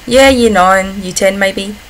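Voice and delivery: usual speaking voice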